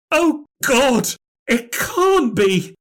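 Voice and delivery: high, slow horrified cry; terrified. Acted. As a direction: gasp it out in terror. She trembles, squirming.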